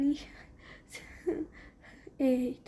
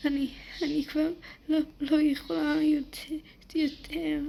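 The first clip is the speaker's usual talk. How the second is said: put-on higher, breaking voice of a crying girl; acted crying; 4 semitones higher than usual. Girl breaking down from the bullying, crying. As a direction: plead as if out of breath from tears.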